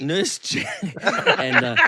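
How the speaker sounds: talking through real laughter; words breaking up in laughs; laughing. Real, not acted. Cracking up over Nurse Jackie, laughing through the words.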